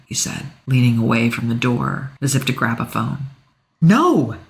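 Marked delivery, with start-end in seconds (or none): 3.8-4.4 sharp, high no; urgent exclaimed no. A narrator's reading. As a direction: blurt it out to stop him. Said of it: "I exclaimed".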